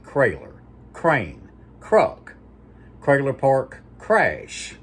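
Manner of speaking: "Crayler, crane, cruck" each started with a hard K sound instead of T; C in place of T. Shown as a demonstration. Vlogger demonstrates the C-for-T habit to viewers, word by word. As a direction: spell out each swapped word, slowly and clearly. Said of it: "He never said anything with a T. It was a C".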